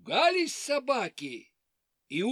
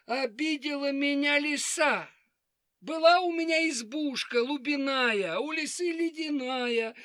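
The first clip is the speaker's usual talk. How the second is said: high weepy hare voice; 6 semitones higher than usual. Acted. Hare complains again.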